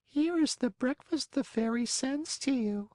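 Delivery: high, unhurried snail voice. Acted. Snail character voice.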